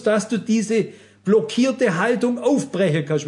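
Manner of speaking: impassioned preaching. Acted. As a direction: drive the point home from the pulpit. Preacher rises in intensity. (eindringliche Predigt mit erhobener Stimme)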